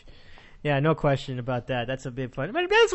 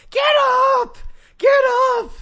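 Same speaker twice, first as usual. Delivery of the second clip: imitating a yell; 8 dB louder than usual. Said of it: "he yelled at the top of his lungs".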